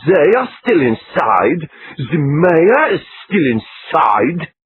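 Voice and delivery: put-on slow, peevish Austrian-American accent. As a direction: drag out the complaint in an Austrian lilt. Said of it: "Austrian-American accent".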